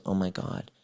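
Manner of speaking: softly imitated stunned realization; a hushed, overcome quote; softly, as if it just hit her; retold tearful awe. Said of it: "She starts crying".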